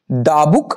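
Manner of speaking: দা as "duh" before book. Shown as a demonstration. Teacher models the first example, দা said "duh" before book. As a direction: open vowel in দা, then book. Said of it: "একের দাগের উচ্চারণটা হচ্ছে".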